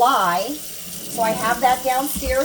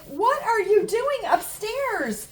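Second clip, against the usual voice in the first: surprised; 10 semitones higher, slower than usual. Retold or imitated. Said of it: "And I was like".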